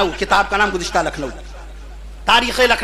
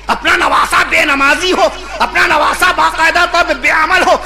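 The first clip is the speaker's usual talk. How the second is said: raised, impassioned sermon voice; fiery preaching; 5 semitones higher than usual. Acted. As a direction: preach it hard to the crowd.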